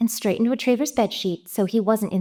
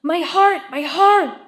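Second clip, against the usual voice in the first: higher, louder mock cry of pain; wife mimicking husband's collapse cry, higher and louder; retold bark; 8 semitones higher, 5 dB louder than usual. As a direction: imitate his panicked yelp. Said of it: "you fell and barked".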